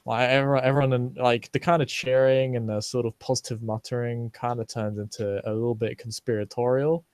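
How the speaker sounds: usual speaking voice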